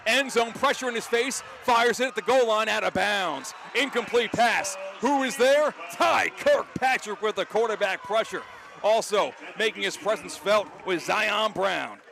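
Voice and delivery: announcer erupts as the pass sails out; excited play call. Real, not acted.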